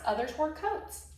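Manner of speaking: ordinary delivery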